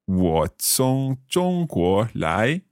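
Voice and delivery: very slow, syllable by syllable; slow learner's repeat. Shown as a demonstration. Learner repeating the teacher's Chinese phrase, very slowly. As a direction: draw out each tone carefully. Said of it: "I'll try repeating them".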